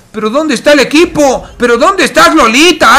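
high, loud disbelief; exasperated shout. Real, not acted. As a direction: cry out in frustration. Caster despairs at a player.

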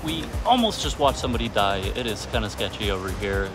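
ordinary talk

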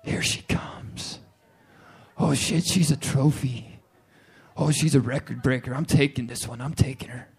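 low, hushed, stalking whisper; hunter's whisper. Pretend. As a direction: whisper as the hunter lines up the shot.

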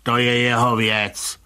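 unbothered grumble; grumbling. A narrator's reading. Commissioner brushing off Rybka's question, grumbling. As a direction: grumble it as none of your business. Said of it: "bručel pan komisař".

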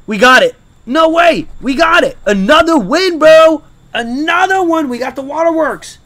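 loud, high joyful yell; excited shouting. Real, not acted. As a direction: cheer the win out loud. Another win.

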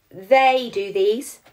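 usual speaking voice

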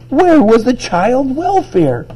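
high, slow outraged demand; all excited. A narrator's reading. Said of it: "got all excited about that".

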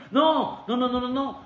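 faux refus joué. Mock denial of own prediction.

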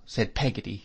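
plain reading voice

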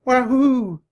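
very high, loud baboon whoop; imitated baboon cry from high up; baboon call. A narrator's reading. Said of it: "The sound came from high above".

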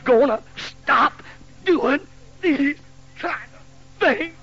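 a hint of a fed-up mother; scolding mother. Mother scolding her son while beating him, mock stern. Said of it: "And she went".

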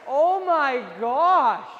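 slow stunned exclamation. Real, not acted. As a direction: drag it out in disbelief. Goalie robs him with toe save.

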